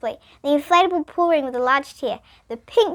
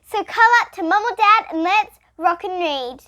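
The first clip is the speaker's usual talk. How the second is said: put-on high, bright host voice calling the kids in; kids' story host; 8 semitones higher, 5 dB louder than usual. Host greeting kids before reading, upbeat. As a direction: welcome little ones to story time.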